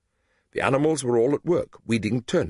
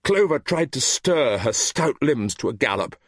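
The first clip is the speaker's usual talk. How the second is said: usual speaking voice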